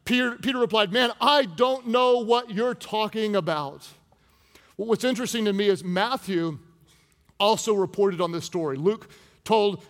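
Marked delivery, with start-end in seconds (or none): 0.9-3.9 higher, slow, drawn-out protest; emphatic denial. Acted. Peter replying to his accuser, emphatic denial. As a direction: deny it slowly and insistently.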